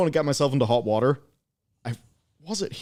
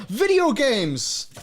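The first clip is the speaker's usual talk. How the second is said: announcer voice; 13 semitones higher, 5 dB louder, much slower than usual. Pretend. Mock announcer topic change.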